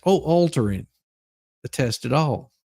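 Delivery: ordinary talk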